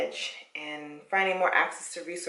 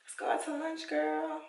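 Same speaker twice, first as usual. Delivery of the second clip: mock friend invite; 9 semitones higher than usual. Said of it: "I'm like".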